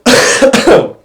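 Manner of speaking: sharp real cough mid-sentence; cough, then push on; congested cough. Real, not acted. Congested guest coughing while explaining budgets. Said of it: "excuse me, I'm a little congested".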